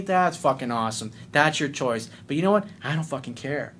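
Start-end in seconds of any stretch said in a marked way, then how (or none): none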